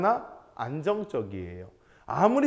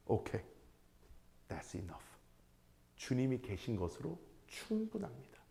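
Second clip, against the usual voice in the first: much softer, hushed and settled; quiet contentment; 4 semitones lower, 15 dB quieter than usual. Retold or imitated. Job, satisfied with God's presence, answering softly. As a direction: murmur it, fully at peace.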